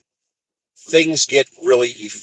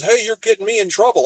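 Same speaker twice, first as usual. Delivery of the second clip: high-pitched mock grievance; agitated complaint; 10 semitones higher than usual. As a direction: imitate an aggrieved guard complaining. Gate guard complains by phone.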